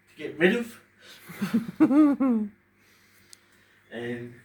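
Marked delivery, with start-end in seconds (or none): 1.0-2.5 sudden high loud laugh; laughing through tears about depression; embarrassed laugh. Real, not acted. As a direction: laugh at yourself mid-sentence. Laughs at his own words.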